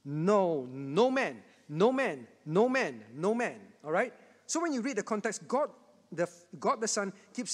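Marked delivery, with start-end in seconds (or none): 0.0-3.6 "no man" repeated with weight on each, pausing between; repeated emphasis. Shown as a demonstration. Preacher showing young and old the verse's repeated emphasis, emphatic. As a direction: hammer home each "no man" as its own phrase. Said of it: "a repeated emphasis of".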